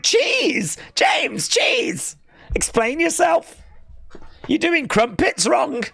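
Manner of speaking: high, incredulous rant; mock outrage. Streamer telling James he is doing crumpets wrong, mock outrage. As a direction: demand an answer, voice shooting up in disbelief.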